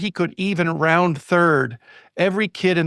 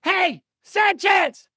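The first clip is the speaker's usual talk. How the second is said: high, drawn-out mock yell; imitated high-pitched holler, slow and stretched; child's yell to player; much slower than usual. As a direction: call out like a little girl across a ballpark. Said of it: "she yells at him".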